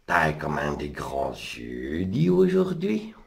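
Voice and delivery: put-on drawn-out wondering child asking her grandmother; little girl's question. Girl questions wolf in grandma's bed.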